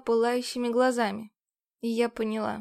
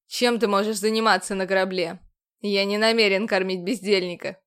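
louder, cold and contemptuous; scornful commander; disdainful; 2 semitones lower, 6 dB louder than usual. A narrator's reading.

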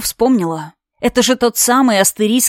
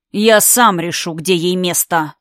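slow, cold and lower; icy tone; 4 semitones lower than usual. Acted. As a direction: freeze her with cold calm. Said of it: "ледяного тона лунданца".

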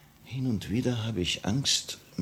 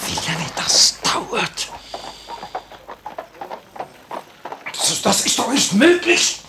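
higher and louder, alarmed; rising panic; 9 semitones higher, 3 dB louder than usual. Acted. Annenkov to Dora as the attack fails, alarmed. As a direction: let panic break through.

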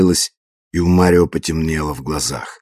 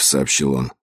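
plain reading voice

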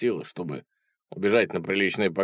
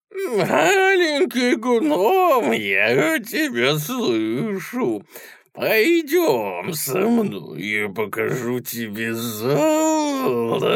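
high, creaky, slow monster voice; high creaky troll voice; 13 semitones higher, 4 dB louder, much slower than usual. Acted. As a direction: croon it like a hungry troll luring prey. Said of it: "высокий и скрипучий".

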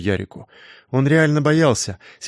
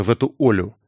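ordinary delivery

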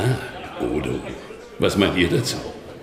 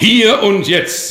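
commanding; 9 semitones higher, 4 dB louder, slower than usual. Acted. Bishop overrules Odo.

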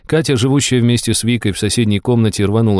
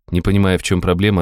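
usual speaking voice

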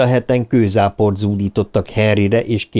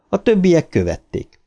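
ordinary delivery

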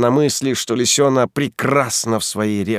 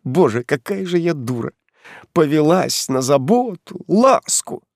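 bitter despair; 6 semitones higher than usual. Acted. Heroine's bitter outburst, upset.

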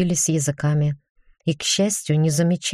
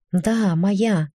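higher, a startled gasp; 4 semitones higher than usual. A narrator's reading. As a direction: gasp it out, caught off guard. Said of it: "ахнула я".

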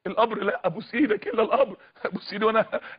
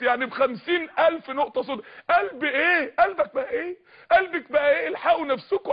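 crying, higher pitched questions; weeping plea; 4 semitones higher than usual. Real, not acted. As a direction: plead through sobs. Preacher weeping over hearts. (سؤال باكٍ متوسل)

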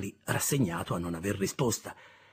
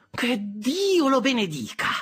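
slow, high, louder mock blessing; sister's sarcastic exclamation, much higher; 14 semitones higher, 6 dB louder, slower than usual. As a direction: bless him meaning the opposite. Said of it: "nel tono di chi vuole significare esattamente il contrario".

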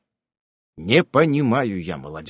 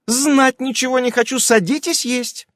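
bossy aunt; 12 semitones higher, 3 dB louder than usual. A narrator's reading. Aunt insisting guests eat.

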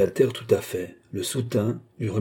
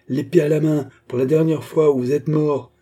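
raised, urgent cry; exclaimed threat; 5 semitones higher, 4 dB louder than usual. A narrator's reading. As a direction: cry out a final warning, pitched higher. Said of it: "s'écria Philippe en bondissant vers le comte". (cri de menace, voix haute et pressante)

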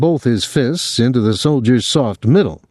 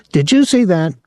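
high, indignant demand; 8 semitones higher than usual. A narrator's reading. Claude to the spectators about the blow, demanding. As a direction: demand it of onlookers, pitched up. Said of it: "Claude demanded of the spectators".

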